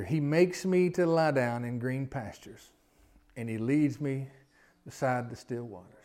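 very slow, pausing scripture reading. Shown as a demonstration. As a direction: read each phrase slowly with pauses. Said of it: "I wanna read 'em kinda slow".

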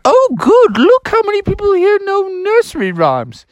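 delighted high falsetto teacher; Mother Goose. Acted. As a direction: exclaim high and loud at the start. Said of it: "the teacher was like".

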